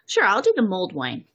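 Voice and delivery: usual speaking voice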